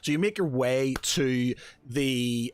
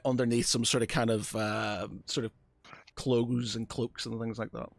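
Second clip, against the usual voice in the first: ordinary delivery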